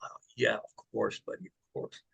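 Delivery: hushed, low, fading to near whisper; tender agreement. Retold or imitated. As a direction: softly reassure a sick child. Moved reply to second sick boy.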